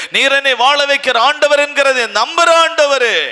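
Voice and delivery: rising high and loud, pleading; fervent prayer. Acted. Preacher leading the congregation's prayer of surrender, rising high and loud. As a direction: pray it out with growing fervor.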